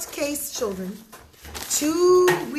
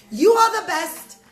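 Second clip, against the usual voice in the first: loud, higher declaration; exultant praise; 4 semitones higher, 9 dB louder than usual. Acted. Judge honors Moshe for his pots, exultant. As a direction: proclaim it with gusto.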